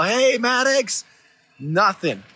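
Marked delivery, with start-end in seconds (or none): 0.0-1.0 put-on high, drawn-out call for a missing kid; mock holler, pitched up and long; retold yell. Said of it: "I yell".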